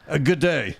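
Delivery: a quick, half-hearted Australian "good day"; Australian accent. Pretend. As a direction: toss off a reluctant Australian greeting. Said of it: "Can I hear your best Australian accent?".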